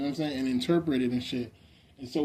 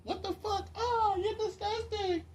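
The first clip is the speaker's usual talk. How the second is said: very high, softer squeal; mock disgust; 7 dB quieter than usual. Reacting to men, high mock-disgusted squeal. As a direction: squeak it out as hammed-up revulsion.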